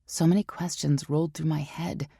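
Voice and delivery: ordinary delivery, nothing marked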